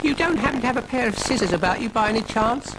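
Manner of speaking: high, quick snail chatter; little snail voice. A narrator's reading. Brian the snail asks Ermintrude for scissors, high quick voice. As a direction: ask it in a fast, high pipe. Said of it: "asked Brian".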